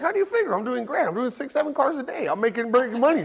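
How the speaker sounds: high, breezy protest of a clueless younger self; mock-naive high-pitched defense. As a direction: play it innocent and puzzled, pitched high. Retelling his naive reply to her.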